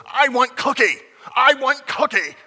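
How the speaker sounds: put-on high, loud whiny child; whiny kid tantrum. Preacher mimics a child's tantrum, high loud whine. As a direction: wail it like a toddler denied a cookie. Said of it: "like a kid that just says".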